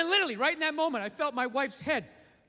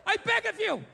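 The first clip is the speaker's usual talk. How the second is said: high, slow, desperate begging; pleading gorilla; 9 semitones higher, slower than usual. Acted. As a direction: beg for rescue, stretching the words high. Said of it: "And the thing would be like".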